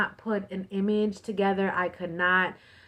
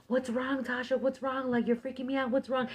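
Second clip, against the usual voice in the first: high, lightly acted worried questioning; retold alarmed brother; 6 semitones higher than usual. Brother on the phone to his crying sister, retold alarm. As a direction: imitate a worried brother, pitched up. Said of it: "he's all like".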